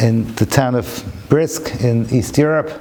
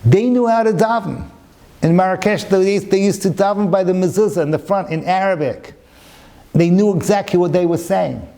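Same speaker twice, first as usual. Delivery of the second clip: pitched-up, emphatic sermon storytelling; impassioned preaching; 6 semitones higher than usual. Real, not acted. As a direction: preach it with rising fervour.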